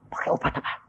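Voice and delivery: whispered, amazed query; hushed question. Retold or imitated. Doctor asking what medicine was used, hushed. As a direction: ask it under the breath.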